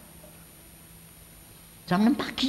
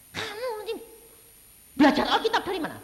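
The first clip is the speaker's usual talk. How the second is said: scolding father; 4 dB louder, faster than usual. Retold or imitated. Father scolds son's Bible quoting.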